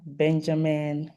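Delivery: ordinary talk, nothing marked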